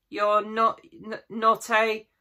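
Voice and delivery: high, faltering, slow stammer; shaky voice. A narrator's reading. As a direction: stumble over the words in fear. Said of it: "asked Susan in a shaky voice".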